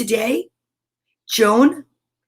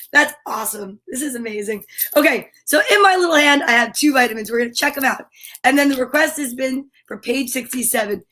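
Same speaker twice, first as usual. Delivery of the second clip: ordinary delivery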